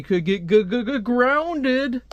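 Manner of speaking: hammed-up fearful stammer, jumping way up in pitch; mock stammering dread. Mock dread at being grounded.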